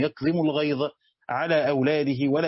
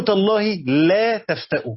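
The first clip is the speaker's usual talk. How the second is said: تالله لا تفتأ slow and louder, لا pressed; slow, louder emphasis on لا in تالله لا تفتأ; stress on the restored لا; 3 semitones higher, 5 dB louder than usual. Shown as a demonstration. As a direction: lean on لا so the missing negation is heard. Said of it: "بحذف حرف النفي".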